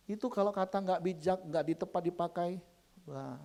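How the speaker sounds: ordinary talk, nothing marked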